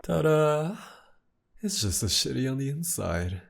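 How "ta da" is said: high, loud showman reveal; sarcastic fanfare; mock ta-da. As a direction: jump up bright and loud like a magician.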